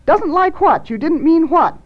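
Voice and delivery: high, loud questioning; urgent demand. Acted. Janice questions Thad about what he did, urgent demand.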